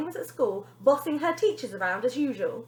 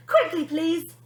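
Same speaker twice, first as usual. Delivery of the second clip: ordering; 5 semitones higher, 5 dB louder, slower than usual. Acted. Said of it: "Bonnie ordered".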